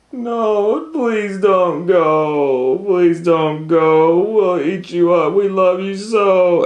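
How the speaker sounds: high, loud, slow begging wail; pleading monsters. Acted. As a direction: beg Max not to leave. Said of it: "the wild things cried".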